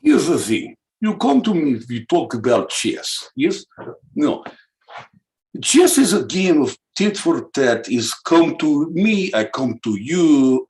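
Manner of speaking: broken-English Russian voice, dropped articles; hammed-up Russian accent. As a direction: play a boastful Russian. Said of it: "in a Russian accent".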